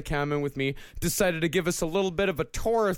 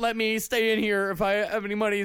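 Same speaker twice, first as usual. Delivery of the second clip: higher, rushed nice-guy pleading; whiny nice guy talking fast; pushy guy voice; 7 semitones higher, faster than usual. Retold or imitated. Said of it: "He's like".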